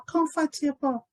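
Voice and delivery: high-pitched "comfortable" in four syllables, com-fa-te-ble; Liberian pronunciation. Retold or imitated. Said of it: "don't say comfortable".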